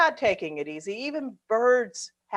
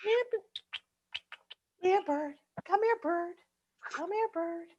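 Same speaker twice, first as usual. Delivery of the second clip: coaxing a wild bird; 10 semitones higher than usual. Acted. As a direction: sweet-talk a bird closer.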